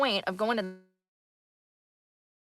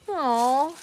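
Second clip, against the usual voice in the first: mock pity; 5 semitones higher, 3 dB louder than usual. Mocking a jealous chatter.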